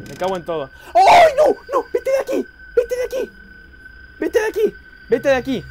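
very high, loud screams; screaming in panic. Real, not acted. Gamer screams at the animatronic to go away. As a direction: scream him away in terror.

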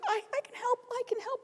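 high, small, soft little spider voice; tiny grandmother spider. Acted. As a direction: squeak it eagerly like a tiny old spider. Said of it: "this little grandmother spider, she's just this big".

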